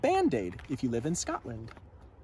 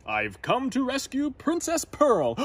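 higher, loud proud knight; knight boasting; 9 semitones higher, 3 dB louder than usual. A narrator's reading. Gadabout introducing himself, higher and loud. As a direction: announce yourself grandly. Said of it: "he said".

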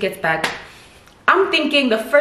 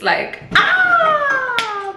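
long, very high drawn-out ah; dramatic wail. Real, not acted. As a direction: let out a theatrical wail, much higher. Dramatic sigh over her hair.

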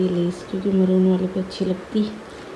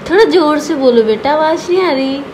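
urging louder; 6 semitones higher, 6 dB louder than usual. Real, not acted. Urging child to speak louder.

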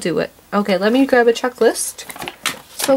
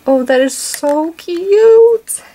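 high, louder, slow gush; gushing squeal; 6 semitones higher, 5 dB louder, much slower than usual. Real, not acted. Vlogger putting both toys on the tray, gushing. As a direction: draw out "so cute" with delight.

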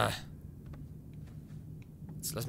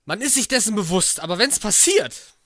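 louder and higher, still rattled; agitated; 9 semitones higher, 13 dB louder than usual. Real, not acted. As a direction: blurt it out still pumped from the scare. Explaining jump scare still rattled. (aufgeregt, laut und hoch)

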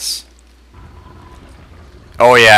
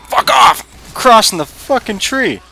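high furious yell; angry shout; 12 semitones higher, 3 dB louder than usual. Real, not acted. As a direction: yell it out in frustration. Yelling at game while balancing.

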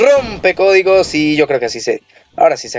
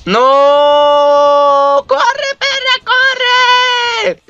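very high falsetto shriek, pitched way up; shrieking yell; 3 dB louder, much slower than usual. Real, not acted. Gamer shrieks at his wrestler to run, falsetto panic yell. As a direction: screech it out in panic.

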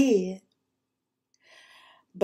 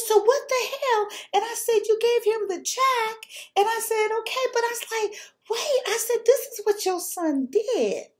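indignant retort. Retold or imitated. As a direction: throw it back at her, fuming. Confronting the mother-in-law.

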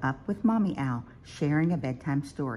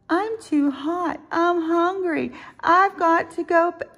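high, loud, insistent little owl wail; crying out complaints; 7 dB louder than usual. A narrator's reading. Little Owl complains to Mommy at bedtime, high wailing. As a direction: pile up the complaints in a child's whine. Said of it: "cried Little Owl".

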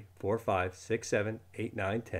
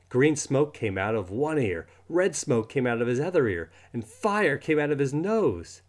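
ordinary delivery

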